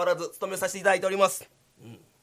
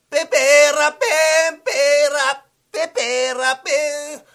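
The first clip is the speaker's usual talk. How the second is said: loud, higher sung plucking noises; mouth shamisen; 6 semitones higher, 10 dB louder than usual. Acted. Guard playing air shamisen, loud mouth strumming. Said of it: "三味線持って".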